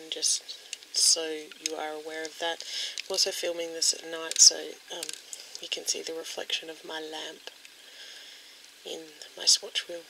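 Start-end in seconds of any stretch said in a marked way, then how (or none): none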